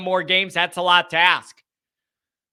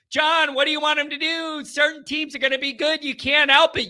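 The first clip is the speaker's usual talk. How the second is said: a higher, lightly voiced imagined critic; mock objector; 7 semitones higher than usual. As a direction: toss in the naysayer's reply, a touch mocking. Imagined objector answering John.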